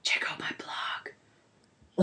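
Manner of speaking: hushed whispered plug; whispering. Real, not acted. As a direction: whisper it like a secret aside. Aside plugging own blog.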